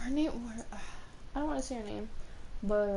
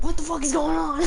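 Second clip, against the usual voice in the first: excited disbelief; 8 semitones higher, 7 dB louder than usual. Retold or imitated. Amazed at friend's compliment.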